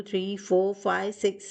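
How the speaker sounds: plain reading voice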